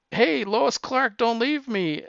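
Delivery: high-pitched mock plea; pleading Lana. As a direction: call after them not to be abandoned, pitched well up. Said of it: "Lana's like".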